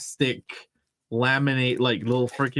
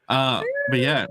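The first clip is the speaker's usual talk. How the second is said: ordinary delivery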